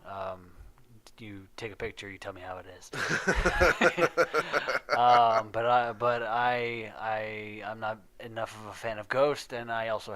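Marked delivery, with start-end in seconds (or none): 2.9-4.9 loud laugh. Real, not acted. Laughing at own joke.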